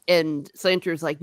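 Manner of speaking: ordinary talk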